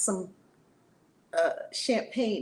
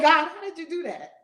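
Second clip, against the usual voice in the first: much higher and louder, astonished; amazed at God; 8 semitones higher, 7 dB louder than usual. Retold or imitated. Laughing on the plane, asking God in amazement. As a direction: cry out in disbelief to God. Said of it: "I'm like".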